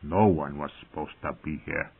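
soft, low grumble; sour muttering. A narrator's reading. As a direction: mutter sullenly to yourself. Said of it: "he muttered sourly".

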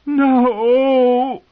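high, loud anguished cry; wailing. A narrator's reading. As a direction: wail in despair. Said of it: "Cersei wailed".

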